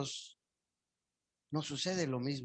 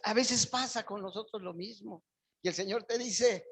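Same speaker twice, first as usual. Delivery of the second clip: ordinary talk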